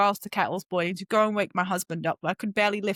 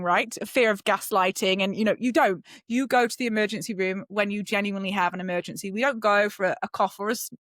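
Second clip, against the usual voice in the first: ordinary talk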